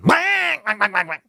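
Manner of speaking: high quack, then dying away; startled duck squawk. Acted. Storyteller voicing duck caught by wildcat, alarmed quack.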